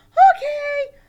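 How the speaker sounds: very high, loud squeal; squeaky puppet yell; eager puppet Sally. Pretend. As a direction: shout agreement as a hyper puppet.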